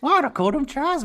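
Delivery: high, loud cartoon Australian; Simpsons Australian accent. Host demonstrates the promised voice, Simpsons Australian accent. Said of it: "use the Simpsons Australian accent".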